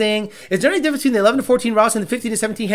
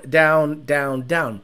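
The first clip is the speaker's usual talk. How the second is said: normal talking voice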